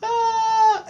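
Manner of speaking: high falsetto mock sobbing; childish crying wail. Little boy crying under the switch, high mock wail. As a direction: wail like a little kid getting whipped. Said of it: "I was crying".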